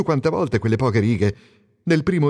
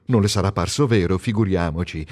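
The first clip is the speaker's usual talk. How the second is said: usual speaking voice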